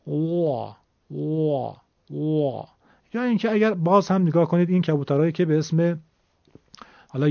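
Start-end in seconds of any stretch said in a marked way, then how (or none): 0.1-2.7 soft cooing wa wa wa; pigeon coo. Shown as a demonstration. Teacher imitating a pigeon coo for the class. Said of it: "صدای کبوتر اگه دقت بکنید تقریباً همینطوره".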